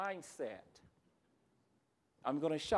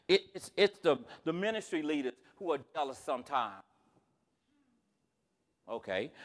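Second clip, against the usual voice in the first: ordinary delivery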